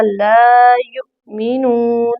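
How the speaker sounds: ला युमिनून with ला held two beats, slow; ला युमिनून with a long opening ला; la held two counts. Shown as a demonstration. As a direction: stretch the ला in ला युमिनून. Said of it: "लाम को दो हरकत लंबा किया जाएगा".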